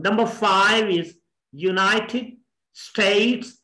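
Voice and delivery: ordinary delivery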